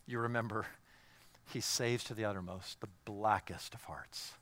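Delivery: soft hushed whisper; breathed, intimate whisper. Acted. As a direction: lean in and whisper it like a secret. Hushed aside to congregation.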